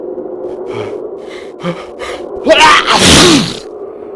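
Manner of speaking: loud gasping sneeze that bursts out; a huge bear sneeze; big sneeze. Acted. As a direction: let the sneeze explode. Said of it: "He blows and he sneezes".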